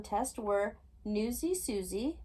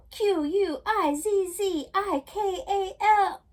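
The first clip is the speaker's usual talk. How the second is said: loud, slow, high spelled-out shout; shouting in sleep; 10 semitones higher, 9 dB louder, slower than usual. A narrator's reading. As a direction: yell each letter as she wakes. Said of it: "She woke up shouting".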